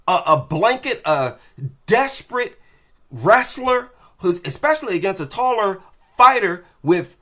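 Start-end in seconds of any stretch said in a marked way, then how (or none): none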